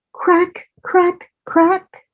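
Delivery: high, crisp cracking sounds. A narrator's reading. Reading to a child, the shell breaking, high crisp cracks. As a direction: snap each crack like eggshell.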